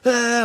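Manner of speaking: loud mock gasp of pain, pitched high; gasping yell. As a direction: yell out as if burning. Choking on fire, gasping.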